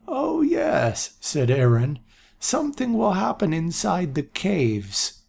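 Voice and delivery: a forgetful prophet suddenly remembering; old seer voice. A narrator's reading. Aaron to the council, high old voice.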